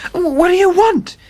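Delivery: high, slow, frightened gasp; gasped in fear. A narrator's reading. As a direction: choke it out in terror. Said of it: "he gasped".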